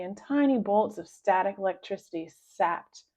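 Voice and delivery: ordinary delivery, nothing marked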